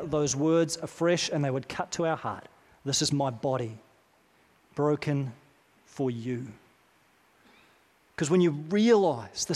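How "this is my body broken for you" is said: preacher softly voicing Jesus to the congregation; hushed tender quote. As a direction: let each phrase land quietly. Words cutting to our heart.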